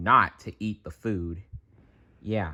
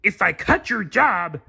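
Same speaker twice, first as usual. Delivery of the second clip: high put-on crab boss voice; Mister Krabs voice; 12 semitones higher than usual. A narrator's reading. Mister Krabs breaking bad news, put-on voice. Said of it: "Mister Krabs explained".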